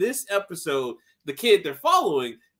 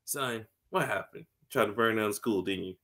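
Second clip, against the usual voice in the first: lower, softer mock elder; lightly hammed-up stern father; low fatherly voice; 8 semitones lower, 6 dB quieter than usual. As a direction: talk down to the boy like a dad. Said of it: "He's just like".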